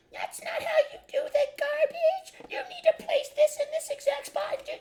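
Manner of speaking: very high, loud screeching imitation of his mother; mocking his nagging mother with a high, loud screech; screeching mom. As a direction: screech it like a barking chihuahua. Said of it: "she would be screeching in the background like a banshee".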